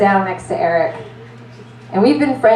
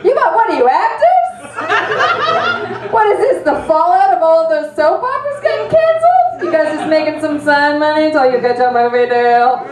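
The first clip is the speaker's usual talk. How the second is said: higher, laughing, teasing the rescuers; giddy dazed flirting; 7 semitones higher than usual. Retold or imitated. Concussed woman teasing paramedics, higher and giggly. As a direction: flirt like a giddy cool aunt.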